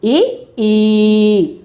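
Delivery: brief ఇ, then ఈ held long and level; teacher sounding out ఇ short and ఈ long. Shown as a demonstration. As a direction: contrast a quick ఇ with a drawn-out ఈ. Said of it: "ఇవి ఏ ఏ అక్షరాలు?".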